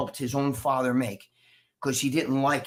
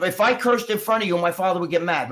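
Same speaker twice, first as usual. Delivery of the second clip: ordinary delivery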